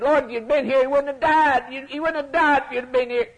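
a grieving sister's lament, retold by a preacher; crying plea. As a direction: plead through mock tears with the Lord. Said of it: "just fell down crying and said".

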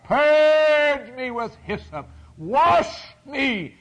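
high, loud and slow, crying out to God; crying out the psalm; impassioned cry. Acted. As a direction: plead it with full voice.

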